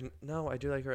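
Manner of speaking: soft, quiet clarifying. Retold or imitated. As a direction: say it quietly, reassuring. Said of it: "I'm just, like, clarifying to people".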